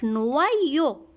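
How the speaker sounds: "noyo" said nwa-yo, with a wa glide; correct reading. Shown as a demonstration. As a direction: model "noyo" as nwa-yo for children. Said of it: "Les lettres O I font le son wa".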